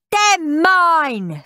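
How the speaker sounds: high, loud possessive scream. A narrator's reading. Henry screams at Steve over the presents. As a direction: scream it, clutching the loot. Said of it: "screamed Henry".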